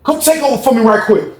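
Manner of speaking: high, urgent call; calling his homeboy. Retold or imitated. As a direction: summon a friend to cover. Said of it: "he said".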